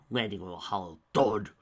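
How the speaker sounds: plain reading voice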